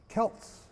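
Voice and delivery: Celts with a hard K sound at the start. Shown as a demonstration. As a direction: say Celts as Kelts. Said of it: "with a hard K".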